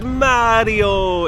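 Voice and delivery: imitating kids calling "Mario" in a big Italian accent, high and stretched. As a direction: sing out "Mario" like an Italian kid. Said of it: "in the most Italian accent I've ever heard".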